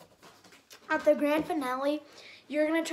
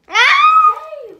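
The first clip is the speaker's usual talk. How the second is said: startled laughing shout; 13 semitones higher, 16 dB louder than usual. Real, not acted.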